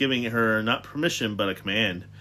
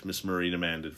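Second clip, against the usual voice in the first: usual speaking voice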